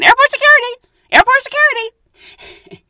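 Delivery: very high, loud repeated shout; mock yell. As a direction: imitate the crazy lady hollering for help. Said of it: "and she's going".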